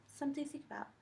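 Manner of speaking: ordinary delivery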